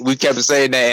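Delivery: normal talking voice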